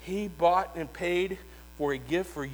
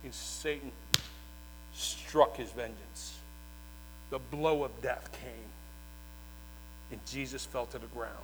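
hushed sermon storytelling; 5 semitones lower, 12 dB quieter than usual. A narrator's reading. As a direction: draw the congregation in with a hush. Hushed telling of crucifixion.

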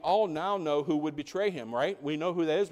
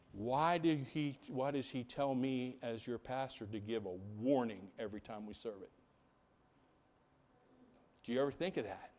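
solemn hush; 6 semitones lower, 12 dB quieter than usual. Real, not acted. Pastor warning his church, a grave soft hush. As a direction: drop to a grave near-hush.

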